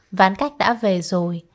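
usual speaking voice

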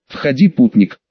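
ordinary delivery, nothing marked

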